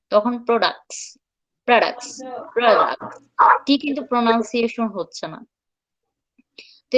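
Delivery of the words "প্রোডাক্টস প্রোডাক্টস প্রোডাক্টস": প্রোডাক্টস said "pradaks" with the t dropped; products demo. Shown as a demonstration. Teacher models প্রোডাক্টস for students, said "pradaks". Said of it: "আর যদি বলেন প্রোডাক্টস".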